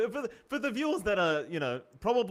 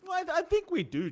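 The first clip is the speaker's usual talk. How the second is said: much higher, louder hammed-up whine; mock whiny; 6 dB louder than usual. To John, repeating the agreement in a high mock whine. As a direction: whine it like a wounded partner.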